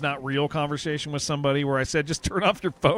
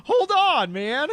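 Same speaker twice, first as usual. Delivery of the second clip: high, loud disbelief; baffled protest; 10 semitones higher, 3 dB louder than usual. Retold or imitated. Streamer reacting to a gamer's odd boast, baffled protest. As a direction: halt everything with a high, incredulous cry.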